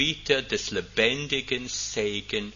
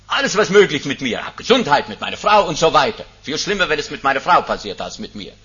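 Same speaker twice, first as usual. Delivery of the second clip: urgent preaching; 5 semitones higher, 9 dB louder, faster than usual. Acted. Pastor tells of his night fears.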